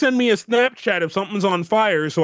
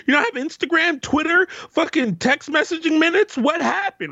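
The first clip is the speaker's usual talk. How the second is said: exasperated rant; 8 semitones higher than usual. Real, not acted. Exasperated rant about calls.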